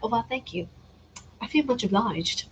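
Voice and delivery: soft mock-British thanks; politely playful British accent. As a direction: answer in a lightly put-on British accent.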